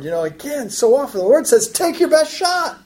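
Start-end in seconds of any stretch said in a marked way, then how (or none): 1.7-2.8 high, drawn-out taunt; mocking challenge, pitched well up. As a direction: goad them with a slow, high-pitched dare. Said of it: "this taunting tone of the Lord".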